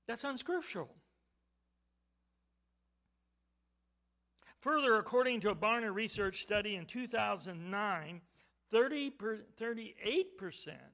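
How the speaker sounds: loud, high, incredulous pulpit delivery; forceful preaching. Real, not acted. Preacher citing surveys on evangelicals' beliefs, forceful preaching. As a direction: punch the figures out to the room.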